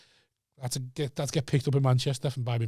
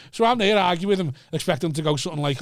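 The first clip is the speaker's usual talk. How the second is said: ordinary delivery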